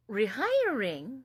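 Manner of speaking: ordinary talk, nothing marked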